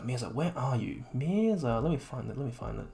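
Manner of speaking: normal talking voice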